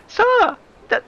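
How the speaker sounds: very high, loud feminine "sir"; woman's voice. Acted. Player redoes the line as a woman, high-pitched call. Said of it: "that was a woman".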